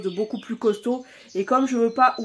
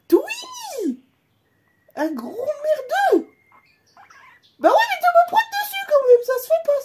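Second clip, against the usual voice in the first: very high, louder whining pet voice; whiny pet voice; 7 dB louder than usual. Pretend. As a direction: whine like the wronged pet. Pet voice scolding.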